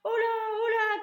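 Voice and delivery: high, loud startled cry; alarmed shout. A narrator's reading. Cook feeling the mouse in his trousers, high loud cry. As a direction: yelp as something climbs your leg. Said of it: "cria l'homme".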